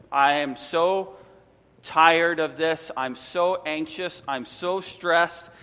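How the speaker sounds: slow, dragged-out whine of exhaustion; drawn-out weary complaint. Retold or imitated. Jonah complaining to the sailors, slow and weary. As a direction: stretch each word like he's fed up. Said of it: "So what he says is this".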